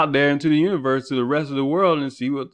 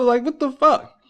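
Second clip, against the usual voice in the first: exasperated disbelief; 12 semitones higher than usual. Real, not acted. Exasperated outburst at endless diseases.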